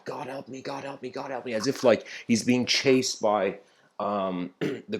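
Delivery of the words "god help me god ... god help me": soft, repeated plea under the breath; pleading prayer. Acted. Hunted slave praying to God in a cave, hushed pleading. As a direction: pray urgently but keep it hushed. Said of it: "the slave is just praying to God".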